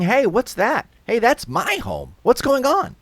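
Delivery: high, loud, indignant protest; alarmed shouting. Acted. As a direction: call out in outraged alarm. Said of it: "the ones who are shouting".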